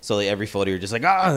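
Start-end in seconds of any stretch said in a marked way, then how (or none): 1.0-1.4 imitating a startled gasp, higher and louder; a quick mock startled yelp. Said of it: "you know, like, startled".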